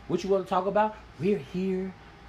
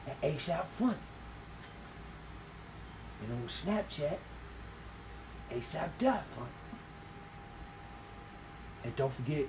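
mock dramatic sign-off, softer; in the brooding actor voice; 8 dB quieter than usual. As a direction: deliver the outro like a brooding TV hero. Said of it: "you want me to tell 'em like this?".

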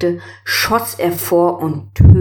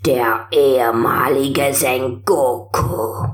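put-on very old man, croaking and slow; 3 semitones lower, slower than usual. A narrator's reading. Old sorcerer speaks.

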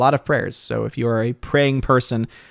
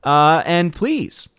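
normal talking voice

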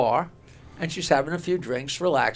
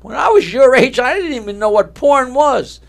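louder, higher acted scolding; mildly indignant father; 6 semitones higher, 7 dB louder than usual. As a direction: lecture the son with a touch of outrage. Father scolds son in joke.

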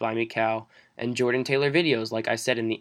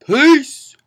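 shouted sign-off; 13 dB louder than usual. Real, not acted.

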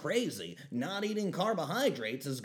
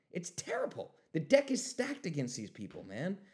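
normal talking voice

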